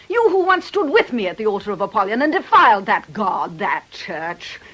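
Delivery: loud, high, ranting accusation; bitter loud tirade building to a shout; furious rant. Acted. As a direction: pour out her fury at him. Aggie's rant at Nick.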